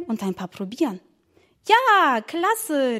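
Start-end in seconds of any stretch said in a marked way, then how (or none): 1.7-3.0 put-on an excited child shouting yes; excited cry. Girl cheering to her brother, excited call. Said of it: "rief Emma".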